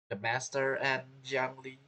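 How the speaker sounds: usual speaking voice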